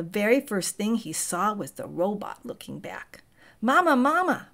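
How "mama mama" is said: put-on high, loud baby-bird cry for mama; peeping gosling. A narrator's reading. Hatchling gosling calls out to the robot, high and loud. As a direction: peep it like a newborn chick calling out. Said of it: "peeped the gosling".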